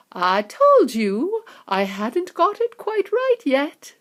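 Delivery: a hint of a rueful inventor; rueful chocolatier shaking his head; sighing Wonka. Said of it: "sighed Mister Wonka".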